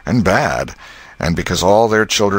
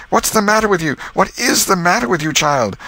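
frantic aunt; 12 semitones higher than usual. Acted.

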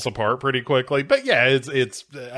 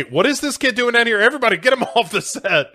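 high, fast crew-member outburst; mock alarm; 9 semitones higher, 3 dB louder, faster than usual. Film crew ordering the intruder off set, laughing mock alarm. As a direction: rush it, pitched up and breaking into a laugh.

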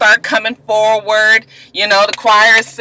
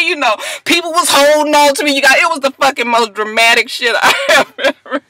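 ordinary talk, nothing marked

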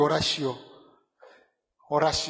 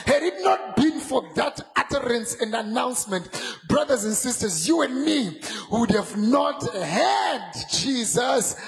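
full-force preaching; 3 semitones higher than usual. Acted. Preacher stirring up brothers and sisters, full-force shouting. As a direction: lift the church, shouting praise.

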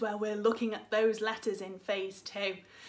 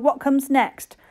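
ordinary delivery

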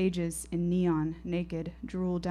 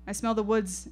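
ordinary delivery, nothing marked

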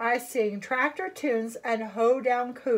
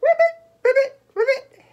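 loud, very high ribbits; high-pitched frog calls; 11 semitones higher, 9 dB louder, faster than usual. Acted. Bullfrog character croaks.

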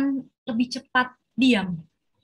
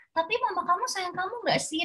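put-on higher, quick, softer question to a child; coaxing a child; 8 semitones higher, 5 dB quieter, faster than usual. Shown as a demonstration. Parent gently probes the child's feelings, soft and quick. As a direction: lightly nudge the child to think. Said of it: "nanti kita omongin lagi".

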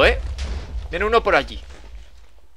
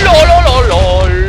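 mocking lol; 6 semitones higher, 10 dB louder, much slower than usual. Real, not acted. Mocking laugh after trapping player.

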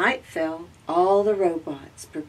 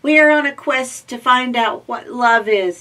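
higher, loud eager robot; little robot voice; 6 semitones higher, 10 dB louder than usual. Acted. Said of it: "explained Z".

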